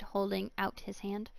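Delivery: ordinary delivery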